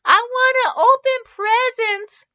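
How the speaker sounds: put-on high, slow, lip-quivering child; tearful little girl. A narrator's reading. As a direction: whimper it like a sad four-year-old. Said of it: "Lyra's lip quivered".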